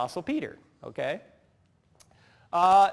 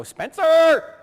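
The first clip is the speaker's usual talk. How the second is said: high, loud call to a son; retold yell; 9 semitones higher, 9 dB louder than usual. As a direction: holler the name across a field. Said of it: "So I yell out".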